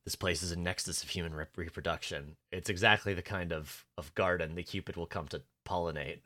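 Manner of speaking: low, very soft character imitation; Castiel. Character describing the restaurant to his partner, low and subdued. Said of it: "Castiel's like".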